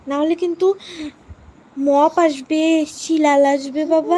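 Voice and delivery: put-on slow it down to spook the child into sleep; spooky bogeyman warning. Scaring baby with bogeyman threat. (বাচ্চাকে ভয় দেখাতে ধীরে টেনে টেনে জুজুর হুমকি)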